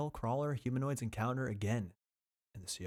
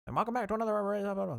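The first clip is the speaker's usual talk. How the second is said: put-on old radio host voice; 9 semitones higher, faster than usual. Shown as a demonstration. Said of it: "the old guy voice".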